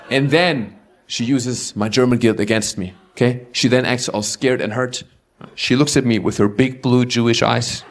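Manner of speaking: ordinary talk, nothing marked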